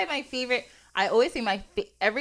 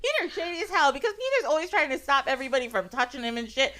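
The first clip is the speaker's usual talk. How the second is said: ordinary talk, nothing marked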